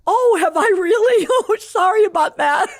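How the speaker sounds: high, loud giggly apology; sheepish laugh. Retold or imitated. Sister complains about Bibles, giggly apology. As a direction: laugh it off innocently.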